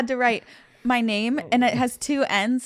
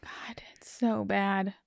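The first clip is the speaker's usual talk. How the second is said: whispering; 2 semitones lower, 9 dB quieter, slower than usual. Real, not acted. Laughing reaction, whispered.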